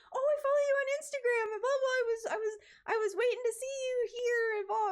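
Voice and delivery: high, fast gushing fan voice. Retold or imitated. Instagram follower running up to her, high gushing. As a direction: rush it out like an eager follower. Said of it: "And they're like".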